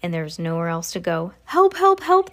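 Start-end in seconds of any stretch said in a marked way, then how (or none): 1.4-2.3 high, loud cries for help; panicked scream. A narrator's reading. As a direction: plead for rescue in a high, raised voice. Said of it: "the man was screaming".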